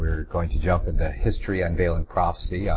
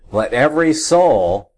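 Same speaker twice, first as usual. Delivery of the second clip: higher-pitched, emphatic "every soul"; stress on "every"; 5 semitones higher, 4 dB louder than usual. Shown as a demonstration. Rereading the verse to correct James, stress on "every". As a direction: press firmly on "every", carrying it into "soul". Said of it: "I'm emphasizing the word every".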